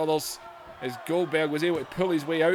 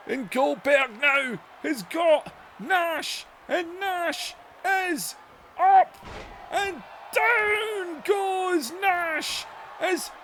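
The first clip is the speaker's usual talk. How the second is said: higher, slow shouted call; excited play-by-play; 11 semitones higher, slower than usual. Acted. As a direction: stretch out the big slam.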